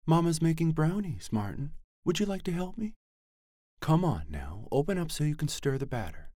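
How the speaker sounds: high, coaxing mother's voice, lilting up; sweet high-pitched mother coaxing her son; languid liquid voice. A narrator's reading. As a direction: coax a child out like a soothing mama. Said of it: "speaking in her languid, liquid voice".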